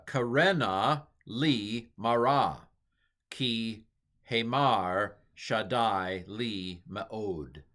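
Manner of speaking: slow, deliberate Hebrew "Karena li mara, ki hemar shaddai li meod"; slow model reading of the Hebrew; careful Hebrew reading. Shown as a demonstration. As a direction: sound out "Karena li mara" syllable by syllable, very slowly. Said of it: "So here's my attempt here".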